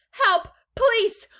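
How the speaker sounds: high, loud scream for help. A narrator's reading. Mother sees her son shoot up the pipe, screamed. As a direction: scream it in alarm, shrill with panic. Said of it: "screamed Missus Gloop".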